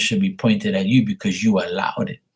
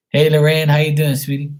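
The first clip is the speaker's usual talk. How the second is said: cheery greeting; 7 semitones higher, 5 dB louder than usual. Real, not acted. Greeting a viewer in chat.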